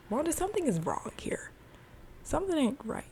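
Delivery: high-pitched acted alarm; worried warning. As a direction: imitate a husband raising concern, voice high. Vision voiced warning his wife.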